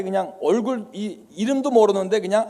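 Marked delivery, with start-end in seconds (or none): none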